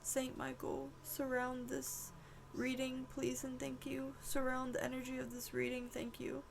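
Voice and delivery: quiet plea to the archangel; hushed prayer. Real, not acted. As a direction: pray under your breath. Whispered urgent prayer.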